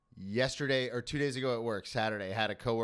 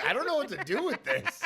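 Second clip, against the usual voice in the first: imitating grandma; 10 semitones higher, 4 dB louder than usual. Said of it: "and she's like".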